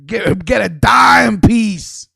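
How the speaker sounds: loud, slow, drawn-out shout; ranting at rappers chasing the same women; emphatic shout. Real, not acted. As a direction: belt it out slowly, like a slogan.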